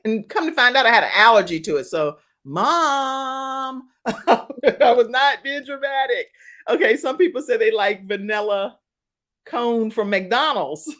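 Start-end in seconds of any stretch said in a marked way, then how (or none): 2.4-6.3 drawn-out, laughing protest; playful vindication. Real, not acted. Daughter telling mom the allergy was real, playful vindication. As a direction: tease mom with a long, raised insistence.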